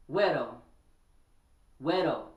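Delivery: güero as WEH-doh, loud and falling; saying güero. Shown as a demonstration. Teaching listeners the word güero, loud WEH-doh. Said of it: "If you don't know what güero means".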